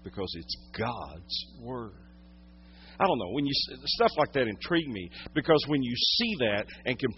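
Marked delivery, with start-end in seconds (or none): 0.0-1.9 low, soft and grave; quiet preacher letting each phrase land; hushed solemn preaching. Real, not acted. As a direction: drop right down to a reverent hush. Hushed, solemn point in sermon.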